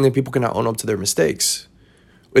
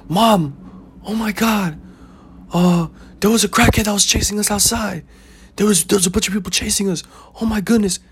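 higher, hammed-up panic, blurting to mom; panicked kid; 8 semitones higher than usual. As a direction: play a scared kid rushing to his mother, a touch hammed. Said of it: "you go up to your mom and you go".

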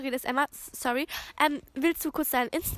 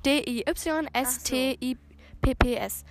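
slow, letter by letter; spelling out slowly; 2 semitones lower, slower than usual. Shown as a demonstration. Host spelling her TikTok name slowly for listeners. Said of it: "D I Ys Tipps ohne Punkt".

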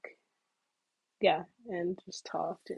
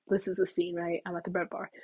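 usual speaking voice

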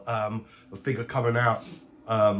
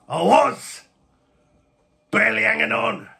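raised, strained character voice; loud, put-on weary reply; battered captive; 9 semitones higher, 5 dB louder than usual. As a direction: admit to barely surviving. Captive answers shocked friend.

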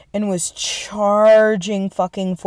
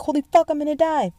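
high, fast mock panic; panicked; 10 semitones higher, faster than usual. Soldiers trapped in a sandwich, high mock panic. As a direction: squeal it like you're about to die. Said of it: "everybody was like".

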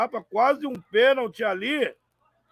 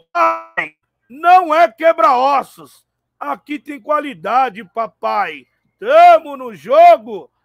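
imitating player; 4 semitones higher, 11 dB louder, slower than usual. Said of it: "ele falou".

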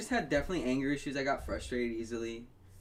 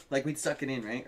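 normal talking voice

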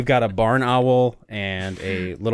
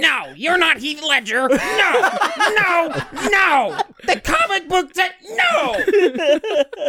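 high, slow villain cackle voice; movie villain voice; much slower than usual. Pretend. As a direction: ham it up like a comic book villain. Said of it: "you sounded like a movie villain".

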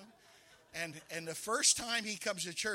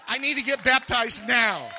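loud, insistent demand; urgent shout; 3 semitones higher, 14 dB louder than usual. Retold or imitated. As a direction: shout the need right now. Rich urgently demanding baptism.

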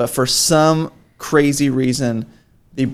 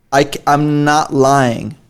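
ordinary talk

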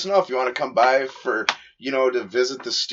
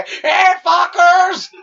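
much higher, louder, slow drawn shout; Mexican comic voice; 7 dB louder, much slower than usual. Retold or imitated. Cohost mimics a comedian's voice, slow high shout. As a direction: stretch it out like a mocking comic. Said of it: "his little Mexican voice".

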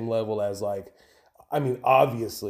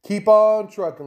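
big sign-off; 10 semitones higher, 9 dB louder than usual. Acted. Podcast sign-off to listeners.

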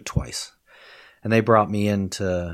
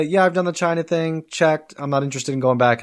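higher, louder bored tourist voice; dismissive students; 8 semitones higher, 4 dB louder than usual. Retold or imitated. Returning students dismissing China, bored. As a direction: shrug off China as done. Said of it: "always come back to me and say".

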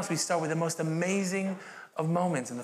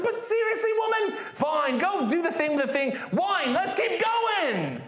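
mock exasperated shout; 11 semitones higher, slower than usual. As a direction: ham up Jesus brushing off his mother. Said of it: "He's like".

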